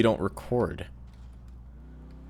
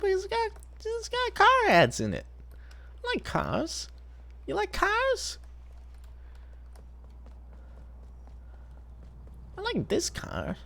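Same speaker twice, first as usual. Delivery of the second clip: very high squeaky Leno voice; Jay Leno impression; 7 dB quieter than usual. Streamer imitating Vinny imitating Jay Leno, very high squeak. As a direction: squeak every line like a cartoon talk-show host.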